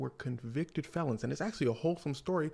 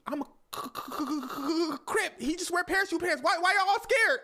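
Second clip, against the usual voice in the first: stutter, then loud high-pitched protest; hammed-up squealing indignation; exasperated sputtering; 7 dB louder than usual. [name] protesting to his scared friends, exasperated.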